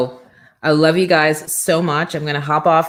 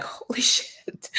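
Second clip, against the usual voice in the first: whispered, slow, with a laugh; stunned whispered curse, half laughing; breathless whisper; 8 dB quieter, slower than usual. Retold or imitated. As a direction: gasp it out under your breath. Panicking, nearly hyperventilating.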